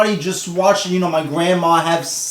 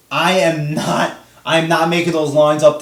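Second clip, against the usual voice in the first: ordinary talk, nothing marked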